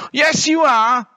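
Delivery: high, insistent retort; high-pitched childish comeback; little bear. A narrator's reading. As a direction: contradict in a small high voice. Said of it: "said the fu-, little one".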